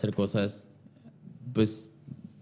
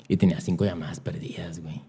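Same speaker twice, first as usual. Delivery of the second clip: unvoiced hushed reveal; suspense whisper; 4 semitones lower, 5 dB quieter than usual. Revealing the missed calls to his friend, hushed. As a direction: whisper it like a secret.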